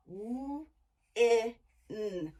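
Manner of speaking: W, I, N each said as a separate sound with pauses; letter-by-letter spelling. Shown as a demonstration. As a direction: tap out each sound slowly. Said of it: "sounding out letters".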